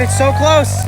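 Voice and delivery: high, loud and thrilled; almost there, can hardly stand it; excited. Real, not acted. Watching the four-wheeler nearly come free, thrilled. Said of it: "I was very freaking excited".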